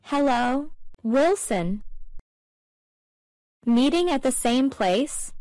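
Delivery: usual speaking voice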